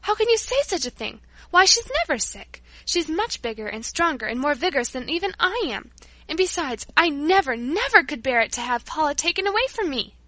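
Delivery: high, loud, upset protest; shouting in protest. Acted. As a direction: cry it out against the dreadful idea. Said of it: "Don't shout that way".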